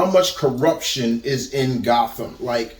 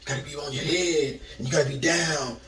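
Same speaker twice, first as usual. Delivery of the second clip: lightly voiced jive-talking cop, fast and softer; a hint of a Shaft-era street talker, rattled off quick; seventies jive talk; 9 dB quieter, much faster than usual. Imitating Jeffrey Wright's Gordon for viewers, light fast jive voice. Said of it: "he talked like this now".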